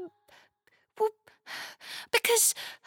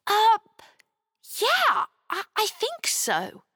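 put-on hesitant girl; 5 semitones higher than usual. A narrator's reading. Chloe answers hesitantly.

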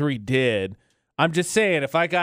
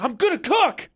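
defiant son; 9 semitones higher than usual. Retold or imitated. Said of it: "He's like".

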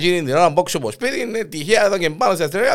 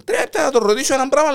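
pleading; 11 semitones higher, 4 dB louder, faster than usual. Retold or imitated. Husband pleads with policeman.

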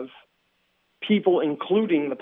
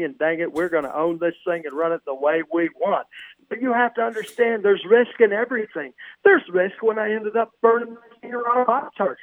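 higher, folksy fired-up coach; rally the team like an old football coach; Coach Lou voice; 5 semitones higher than usual. Pretend. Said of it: "in your wonderful Coach Lou voice".

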